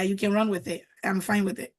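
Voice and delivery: ordinary talk, nothing marked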